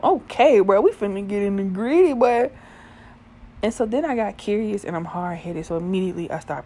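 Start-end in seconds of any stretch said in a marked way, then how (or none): 0.0-2.5 loud, higher burst of retold excitement; hyped-up reaction. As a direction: act out getting hyped with a buddy.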